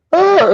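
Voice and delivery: high stretched fake yawn; mock yawn. A man yawning at his pet bird on the exercise machine, high mock yawn.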